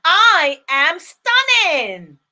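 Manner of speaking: with conviction. Shown as a demonstration. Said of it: "make sure you shout it with so much conviction".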